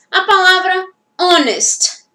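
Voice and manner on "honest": "honest" starting on the vowel, as "onest"; silent H. Shown as a demonstration. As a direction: say "honest" clearly with no H breath. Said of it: "Não escuto". ("honest" com H mudo, bem articulado)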